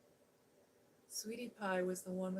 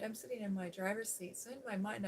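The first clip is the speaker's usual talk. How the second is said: ordinary talk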